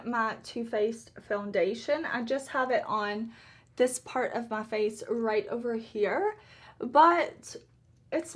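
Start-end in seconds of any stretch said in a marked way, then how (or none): none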